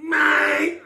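high shouted Mate in her voice; mock Nat voice. Nat shouting back at him on the show, high yell. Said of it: "It's like".

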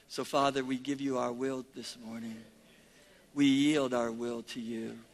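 low, soft, steady praying voice; prayer of surrender. Real, not acted. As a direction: pray it quietly, yielding to God. Prays aloud.

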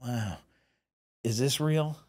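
soft, breathy near-whisper of amazement; a producer's hushed wonder at the makeup; hushed awe. Retold or imitated. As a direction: marvel at it under your breath. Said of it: "he goes like this".

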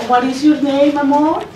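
usual speaking voice

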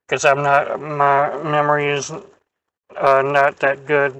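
talking with mouth full; muffled speech between bites; chewing while talking. Real, not acted. Seen 4: Closed, bulging cheeks and chewing mouth throughout; no waffle visible.

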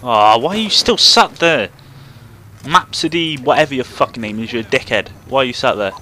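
loud furious yelling at a teammate; angry rant. Real, not acted. Gamer yelling at idle teammate Mapsody, loud and furious. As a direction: rage at him for sitting still.

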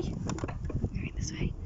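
slow whispered repeat. Real, not acted. Owner whispers to the nervous cat. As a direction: breathe it so the cat isn't scared.

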